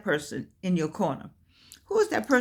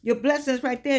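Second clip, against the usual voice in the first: ordinary delivery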